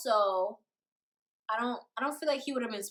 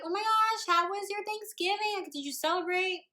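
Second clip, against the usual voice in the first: higher, bright questions; cheery; 7 semitones higher than usual. Retold or imitated. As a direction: ask brightly. Cheery greeting retold.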